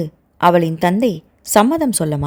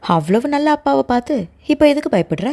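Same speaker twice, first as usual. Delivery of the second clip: playful mocking question, pitched higher; teasing; 5 semitones higher than usual. A narrator's reading. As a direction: needle her gently, keep up the joke. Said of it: "இன்னும் கேலியை விடாமல்".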